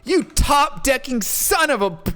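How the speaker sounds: sudden high angry shout, cut off; furious outburst. Retold or imitated. Angry opponent curses the player who drew the winning card, high angry shout. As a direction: snap at him in rage. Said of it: "suddenly goes".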